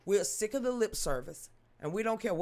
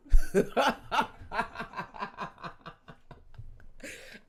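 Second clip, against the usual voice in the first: burst of laughter; 3 semitones higher, 4 dB louder than usual. Real, not acted. Laughs at own blunt remark.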